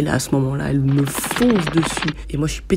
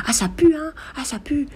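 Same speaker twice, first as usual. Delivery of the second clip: put-on crying old witch; 11 semitones higher than usual. Old witch crying out at two friends, high-pitched mock yell. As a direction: squawk it like a crazy old woman. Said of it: "elle nous crie".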